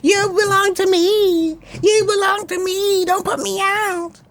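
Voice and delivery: high, slow pleading wife voice; imitating crying wife. He imitates his crying wife refusing to leave, high pleading voice. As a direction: mimic her begging him to let her stay. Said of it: "She was crying".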